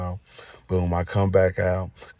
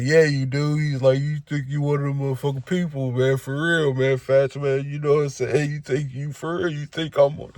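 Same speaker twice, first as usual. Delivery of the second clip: higher, amused needling tone; teasing inmate voice; 6 semitones higher than usual. Retold or imitated. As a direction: poke fun at him, voice pitched up. Inmate teasing Fats, voiced high.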